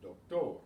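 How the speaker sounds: "doctor" said Spanish-style as dok-TOR; stress on final syllable. Shown as a demonstration. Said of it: "it's stressed on the final syllable".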